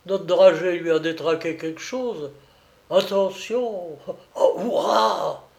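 put-on high, lively old seaman voice; old Breton sailor, worked up and cheering; excited old sailor. A narrator's reading. Old sailor cheering as the enemy sub is hit, excited.

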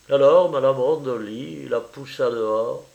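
ordinary delivery, nothing marked

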